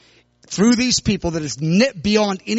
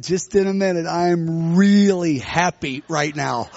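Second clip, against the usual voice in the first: slow, stretched-out contented reply; happy, not giddy; 3 semitones lower, slower than usual. Retold or imitated. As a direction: put them off in dreamy bliss. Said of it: "I wasn't that kind of happy".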